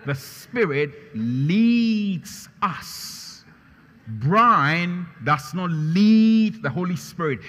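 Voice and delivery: thundering pulpit voice; preaching at full force. Acted. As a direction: proclaim it to the congregation. Preaching climax.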